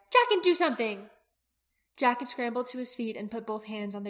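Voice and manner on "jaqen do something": high, loud urgent plea; alarmed cry. A narrator's reading. Aki begging Jaqen to act, high urgent cry. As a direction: cry out for help, pitched well up. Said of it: "Aki cried".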